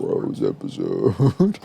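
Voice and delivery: hammed-up deep slow repeat of the title; deep mock echo. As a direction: drop the voice way down and stretch it like a movie announcer. Mock-echoing the episode title.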